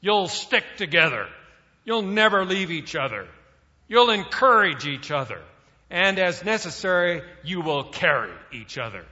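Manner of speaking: loud growled order; growling drill sergeant. Retold or imitated. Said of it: "he growled".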